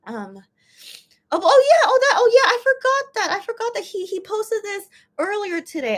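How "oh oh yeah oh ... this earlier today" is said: sudden realization. Real, not acted. Suddenly remembers the post.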